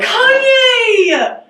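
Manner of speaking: high, drawn-out holler; excited shout. Real, not acted. Student names a celebrity from Chicago, excited high shout. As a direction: belt out the star's name.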